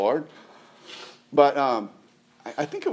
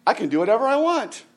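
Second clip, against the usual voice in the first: raised pitch, lightly mocking defiance; mock-carefree objector; 8 semitones higher, faster than usual. Preacher voicing someone who misreads grace, mock-carefree. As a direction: play a cavalier objector.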